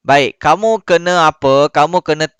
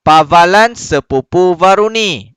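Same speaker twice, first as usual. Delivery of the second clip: smooth, connected model reading; bacaan lancar; 2 semitones higher, 4 dB louder, slower than usual. Shown as a demonstration. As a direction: read the phrase fluently as one unit. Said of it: "Okey, contoh macam ni". (baca lancar tanpa tersekat-sekat)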